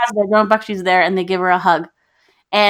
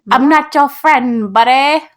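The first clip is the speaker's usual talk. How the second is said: higher, drawn-out cartoon Canadian accent; mock Canadian accent, pitched up; South Park Canadian; 4 semitones higher, slower than usual. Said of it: "reminds me of the Canadian figures in South Park".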